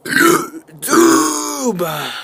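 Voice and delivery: word pushed out on a burp. Real, not acted. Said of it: "not doing good on the YouTube today".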